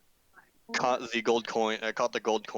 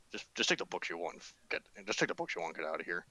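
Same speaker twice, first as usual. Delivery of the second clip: low, soft, uneasy dismissal; wary shopkeeper, quiet; 6 semitones lower, 8 dB quieter than usual. Acted. Confused shopkeeper to a wizard customer, quiet and wary. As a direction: quietly send the odd customer away.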